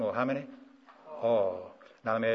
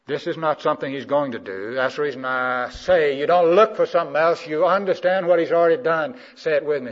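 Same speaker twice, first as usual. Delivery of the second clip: loud, raised, driving sermon voice; forceful preaching; 6 semitones higher, 10 dB louder than usual. Real, not acted. Preacher telling believers it is already done, forceful preaching. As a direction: drive the point home to the congregation.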